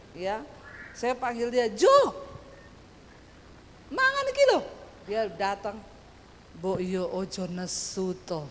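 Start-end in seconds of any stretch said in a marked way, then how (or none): 1.7-4.6 high, loud holler to come and eat; high-toned call. Retold or imitated. Wife summoning husband to eat, high loud call. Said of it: "nadamu ki ora usah dhuwur ngono". 6.6-8.4 mild husband's plea. Retold or imitated. Husband asking wife not to be cross, low gentle plea.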